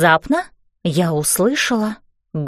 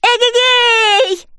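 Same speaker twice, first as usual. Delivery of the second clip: high, drawn-out whoop of glee; excited cry; 12 semitones higher, 3 dB louder, much slower than usual. A narrator's reading. Girl on a flying bed, excited cry. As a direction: whoop it long and high, riding the jump. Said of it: "и я закричала".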